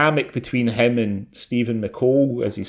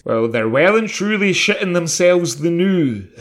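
higher-pitched mock Irish delivery; imitating raised Irish voice; 6 semitones higher, 4 dB louder than usual. Quoting the gang boss's line back to a co-host, raised mock Irish accent. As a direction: mimic the actor raising his voice in an Irish accent. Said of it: "whenever he had to raise his voice".

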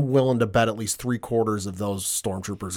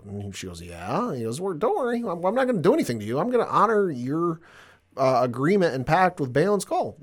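a raised-pitch retelling of a smooth villain's offer; lightly voiced schemer making a deal; imitating Thrawn; 6 semitones higher than usual. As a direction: play the cool tactician in a higher voice, lightly. Retelling Thrawn's lines to Sabine.

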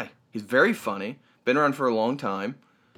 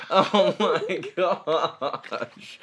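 very drawn-out words through laughter; laughing; 3 semitones higher, much slower than usual. Real, not acted. Host giving up after the bickering, laughing. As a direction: let the phrase dissolve into slow laughing.

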